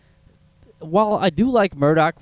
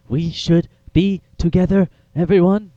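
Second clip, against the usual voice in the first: halting, higher-pitched creepy scientist; creepy doctor impression; 6 semitones higher, 4 dB louder, slower than usual. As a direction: imitate a strange doctor, slow and word by word. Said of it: "he kinda talks like this".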